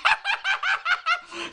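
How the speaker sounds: a high burst of laughter; high cackle. Real, not acted. Laughing about the radio that wouldn't turn off, high cackle. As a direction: crack up at the stuck radio.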